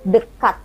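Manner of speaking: dekat with a clearly released T; final T sounded. Shown as a demonstration. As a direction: finish dekat crisply on the T, Indonesian style. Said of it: "Si T-nya itu betul-betul terdengar". (dekat logat Indonesia, T jelas)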